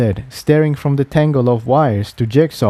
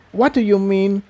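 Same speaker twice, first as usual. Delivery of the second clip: put-on high, sniffly, hurt young voice; small sniff; 8 semitones higher than usual. A narrator's reading. Tiptoe asking Jigsaw what he means, sniffling. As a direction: ask while holding back tears. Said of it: "with a small sniff".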